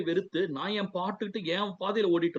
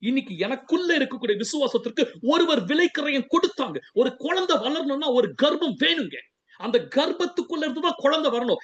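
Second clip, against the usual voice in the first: raised, emphatic preacher's voice; impassioned preaching; 5 semitones higher than usual. Real, not acted. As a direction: drive the point home with a higher pitch. Preaches with rising force.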